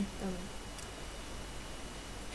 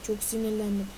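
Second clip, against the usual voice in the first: ordinary talk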